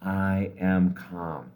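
very slow, hushed-down mantra; slow calm affirmation. Shown as a demonstration. Coach leads the exhale affirmation, slow and calm. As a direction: settle gently into each word. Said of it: "do it very slowly".

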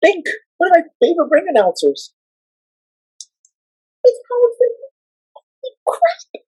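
high-pitched starstruck gushing; giddy fan. Retold or imitated. Fan meets favorite ring announcer, giddy high-pitched awe. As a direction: gush in a high, awed squeal. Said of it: "I felt like a little girl in a candy store".

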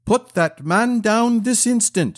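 high, slow command at normal volume; angry shout. A narrator's reading. Newcomer orders giant to release friar, angry command. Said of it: "shouted one in an angry tone".

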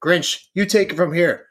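ordinary talk

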